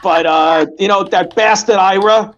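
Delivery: dish the dirt like a nosy Bronx grandma; New York accent. Acted. Old New York lady trashing her neighbours, in a thick New York accent. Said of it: "all that New York just came out".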